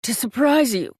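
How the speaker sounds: lightly voiced wheezing man. A narrator's reading. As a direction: push the words out short of breath. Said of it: "Patrick wheezed".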